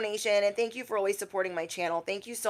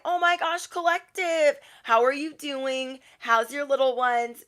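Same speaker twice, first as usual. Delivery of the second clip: gushing greeting; 6 semitones higher than usual. Acted. People greeting you at the post office, gushing. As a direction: gush like greeting someone famous.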